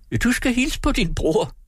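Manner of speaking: high, ringing call to the back room; landlady calls out to Morten at his work, shouting; shouted. A narrator's reading. Madame Blad calls Morten to greet his brother, high shout. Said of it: "råbte Madame Blad".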